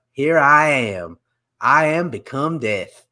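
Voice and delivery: put-on slow, drawn-out Appalachian accent. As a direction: imitate a deadly serious Appalachian monologue, drawing it out. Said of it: "she's got, like, the, the Appalachian accent".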